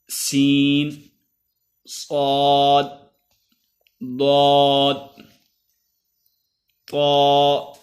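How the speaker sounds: سین light, صاد heavy and full, each drawn out; sin versus sad contrast. Shown as a demonstration. Teacher contrasting sin and sad for students. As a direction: make the difference between سین and صاد audible. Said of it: "آپ سن سکتے ہیں".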